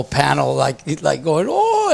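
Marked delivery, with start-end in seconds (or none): 1.5-1.9 surprised oh. Retold or imitated. Judges reacting to a wave.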